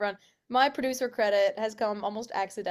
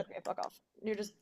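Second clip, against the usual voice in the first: whispered aside; 7 dB quieter than usual. Real, not acted. Muttered aside at a glitch.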